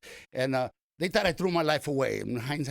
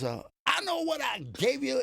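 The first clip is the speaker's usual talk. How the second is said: much higher, impatient retort; gruff Pryor; 10 semitones higher than usual. Retold or imitated. Pryor telling the valet to keep change, impatient. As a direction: snap it back like an annoyed star. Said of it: "He goes".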